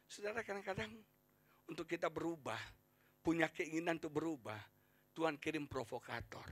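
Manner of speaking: ordinary delivery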